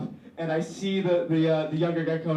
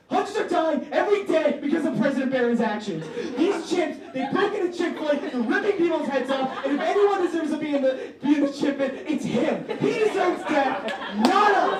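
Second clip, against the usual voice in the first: high, ringing mock outrage, belted out; theatrical shouting; 10 semitones higher, 3 dB louder than usual. Student replaying his own radio rant, shouting in hammed-up outrage. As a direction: rant like a furious radio host accusing the president. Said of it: "So I just hear myself shouting".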